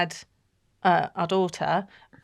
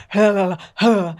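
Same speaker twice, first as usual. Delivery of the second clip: panicky gasps; 6 dB louder than usual. Shown as a demonstration. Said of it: "it makes me like".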